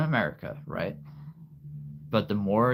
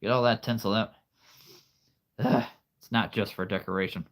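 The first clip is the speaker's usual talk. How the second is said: ordinary talk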